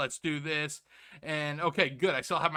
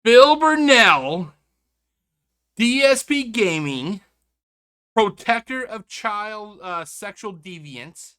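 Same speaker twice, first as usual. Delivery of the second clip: slow, booming reveal, much louder; dramatic announcement; 5 semitones higher, 12 dB louder, slower than usual. Acted. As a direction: drag out each name like a grand unveiling.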